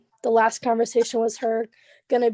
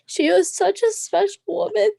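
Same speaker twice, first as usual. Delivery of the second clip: high, louder, tearful tribute; starting to cry; 8 semitones higher, 5 dB louder than usual. Real, not acted. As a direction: let real tears rise into the voice. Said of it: "Sorry, I might cry".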